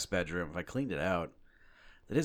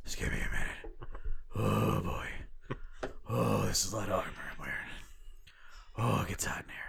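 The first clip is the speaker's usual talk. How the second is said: puffing, voice fading into breath; comically out of breath; winded Batman. Acted. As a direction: gasp it out like an exhausted hero in heavy armor. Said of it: "not physically fit enough to do the Batman".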